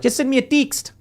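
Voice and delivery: high-pitched New Zealand imitation; lilting mock New Zealand accent. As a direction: mimic a New Zealander, "teekst" for text. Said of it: "someone in New Zealand".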